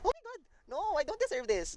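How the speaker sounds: high, thrilled disbelief; can't believe the win; overjoyed squeal. Real, not acted. Getting five stars in game.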